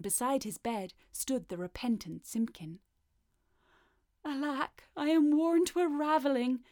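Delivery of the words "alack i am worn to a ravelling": lightly voiced tired old tailor; a hint of an exhausted tailor; weary old tailor. A narrator's reading. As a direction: sigh it out as a worn old man. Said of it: "said the Tailor of Gloucester".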